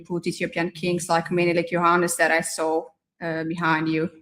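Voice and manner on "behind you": ordinary delivery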